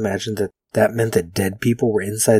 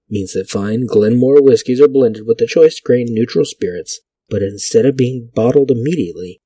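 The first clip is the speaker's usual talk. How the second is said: louder vintage announcer read; 7 dB louder than usual. Acted. Vlogger reads an old whiskey ad aloud in announcer voice. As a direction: sell it like a fifties radio ad.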